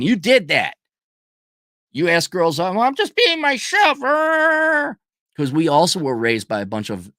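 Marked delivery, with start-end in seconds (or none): 2.6-5.0 mock naive boy. Mocking boys' sincere line.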